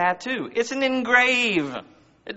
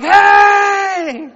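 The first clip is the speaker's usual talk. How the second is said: huge high, drawn-out "hey" shout; excited cheering dad; 11 semitones higher, 9 dB louder than usual. Retold or imitated. Proud father at his kid's soccer game, excited shout. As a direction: holler proudly from the sideline. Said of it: "I'm like".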